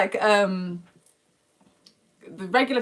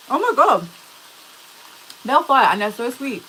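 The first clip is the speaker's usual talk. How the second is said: higher and louder, thrilled by the taste; excited delight; 6 semitones higher, 8 dB louder than usual. Real, not acted. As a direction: gush about how good it is. Tasting the kiwi, delighted.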